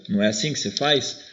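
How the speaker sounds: usual speaking voice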